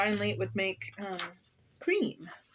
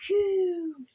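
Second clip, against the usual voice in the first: put-on baby coo; 9 semitones higher, 4 dB louder than usual. A narrator's reading. The hidden baby ghost coos. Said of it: "startled to hear quite plainly".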